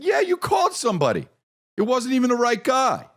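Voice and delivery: high, fast, near-shouted complaint; exasperated shout. Retold or imitated. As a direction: blurt it out high and fed up.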